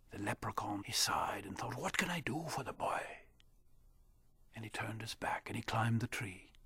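soft unvoiced whisper; whispered narration. A narrator's reading. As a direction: tell it under your breath. Leprechaun sighs at night.

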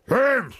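grudging high hmm from the king; a short unimpressed harrumph; king's harumph. Acted. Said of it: "The king harumphed".

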